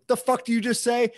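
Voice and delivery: high-pitched indignant challenge; retold anger. Confronting the guy who took the cab, retold anger. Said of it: "I got, like, angry".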